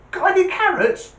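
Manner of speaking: very high, squeaky little rabbit voice; joke rabbit. Acted. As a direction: pipe it up high as a cheeky bunny. Rabbit asks again in joke.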